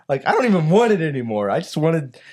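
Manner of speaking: high, worked-up retold complaint; exasperated protest. Retelling own frustration at drive-through.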